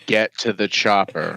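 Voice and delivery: put-on low, deflated accentless movie line; depressed action star. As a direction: say it low, drained of all fight. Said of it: "he's getting more and more depressed".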